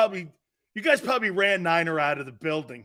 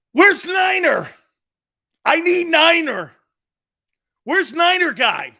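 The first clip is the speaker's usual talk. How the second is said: high, loud hollering for someone; calling out; 10 semitones higher, 8 dB louder than usual. Pretend. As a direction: shout it out high, searching for him. Calling out for missing Niner.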